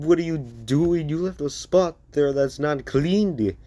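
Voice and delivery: high, loud scolding; berating. Acted. Izuku scolding Eren for a missed spot, high and loud. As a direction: tear into him over a missed spot. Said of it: "start berating him".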